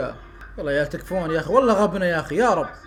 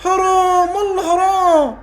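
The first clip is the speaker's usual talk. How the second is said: high, slowed cry of what a waste; lament; 7 semitones higher, 3 dB louder, slower than usual. Real, not acted. Fan mourning Firmino's missed header, pained lament. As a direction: groan over the wasted chance.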